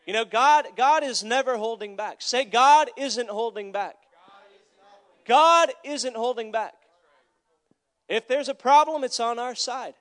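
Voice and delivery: loud, declarative sermon voice; emphatic preaching. Real, not acted. Preacher leading the congregation in a declaration, emphatic.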